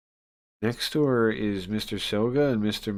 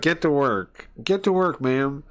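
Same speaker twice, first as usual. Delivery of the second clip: bossy order; 5 semitones higher, 5 dB louder than usual. Acted. Voicing bossy woman ordering her around.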